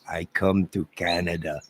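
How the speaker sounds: usual speaking voice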